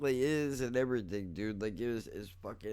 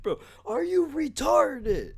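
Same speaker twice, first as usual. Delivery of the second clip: much higher, louder, slow mocking yell; hammed-up furious friend calling you dumb; angry outburst; 9 dB louder, slower than usual. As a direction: throw it at him like he's an idiot. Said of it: "he was really upset".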